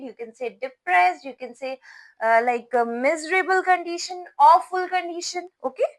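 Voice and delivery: normal talking voice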